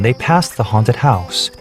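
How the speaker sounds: ordinary delivery, nothing marked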